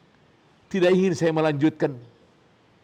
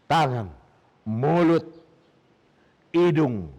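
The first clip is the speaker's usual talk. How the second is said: normal talking voice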